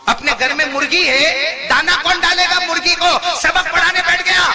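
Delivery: high, loud telling-off; full-voiced mock rebuke; acted scolding shout. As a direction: play a mother yelling at her son. Mother scolding, repeated at full voice.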